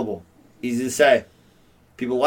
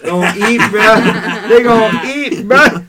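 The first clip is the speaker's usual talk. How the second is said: loud, high hype with laughter; hyped; 6 semitones higher, 8 dB louder, much slower than usual. Real, not acted. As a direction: get fired up and laugh through it. Hyping the Bills defense.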